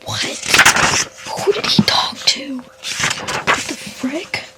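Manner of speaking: stunned whisper; hushed, dazed after the ending. Real, not acted. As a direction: whisper in disbelief. Whispering after finishing the book.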